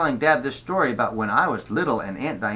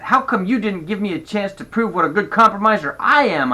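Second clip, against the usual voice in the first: put-on mildly indignant kid, higher pitched; mad; 6 semitones higher, 3 dB louder than usual. A narrator's reading. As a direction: protest it to Dad in a higher voice. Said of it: "That made me mad".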